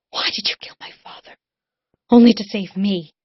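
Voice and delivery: from hush to anguished cry; whisper building to an outburst. Acted. Cullen accusing Rune, whisper rising to loud cry.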